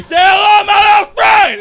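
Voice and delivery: very high, strained impersonated shout; Tony from Scarface. Retold or imitated. Coked-up guy in a tower, hammed-up gangster scream. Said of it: "fucking guy from Scarface".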